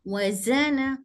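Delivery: ordinary talk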